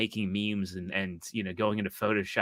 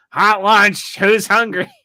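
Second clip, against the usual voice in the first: high, loud goofy lunch-lady call; lunch guy impression; 10 semitones higher, 11 dB louder, slower than usual. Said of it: "the only things that he ever would say is".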